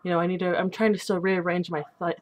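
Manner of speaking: ordinary delivery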